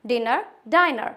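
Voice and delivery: dinner short i then diner long i, slowly; slow contrast: dinner, diner; contrast pair. Shown as a demonstration. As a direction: stretch out dinner and diner to show the vowel change. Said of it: "डिनर और डाइनर क्यों हुआ".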